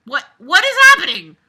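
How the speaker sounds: high, loud shocked cry; surprised outburst. Real, not acted. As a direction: blurt it out in shock.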